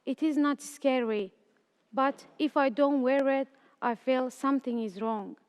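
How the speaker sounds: plain reading voice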